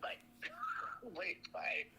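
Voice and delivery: laughing whispered goodbye; playful whispered goodbye. Real, not acted.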